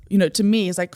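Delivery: ordinary talk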